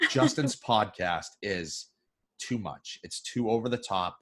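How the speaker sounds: low, soft fussy mother-in-law voice; mock mother-in-law. Imitating his mother-in-law complaining to her daughter, low and soft. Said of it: "most mother-in-laws would probably".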